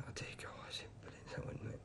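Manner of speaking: hushed, breathy whisper while reliving the pain. Real, not acted. Patient recalling nurse tearing wound, whispering. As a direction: drop to a whisper as the memory hurts. Retelling the agonising wound cleaning.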